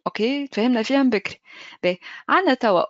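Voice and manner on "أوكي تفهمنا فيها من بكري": ordinary delivery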